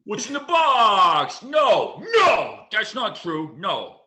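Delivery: anguished, drawn-out shouting impression; Brad Pitt. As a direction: wail it loud and high, stretching each cry. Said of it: "You got a good Brad Pitt".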